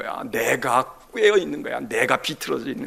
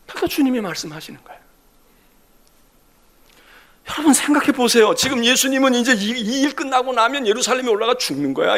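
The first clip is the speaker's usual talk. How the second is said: impassioned Korean sermon voice, rising and falling; preaching; 3 semitones higher, 4 dB louder than usual. Acted. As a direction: preach it to the congregation. (설교하는 목소리)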